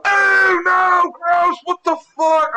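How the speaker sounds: very high, loud, drawn-out wail; retold horrified shout. Son smacked in the face by dildo, disgusted shout. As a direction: yell it in exaggerated revulsion.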